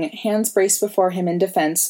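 ordinary delivery, nothing marked

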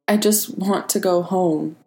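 small, broken-hearted murmur; understated tearful plea; whispered, voice cracking. A narrator's reading. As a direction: plead weakly, close to breaking. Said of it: "he whispered, voice cracking in half".

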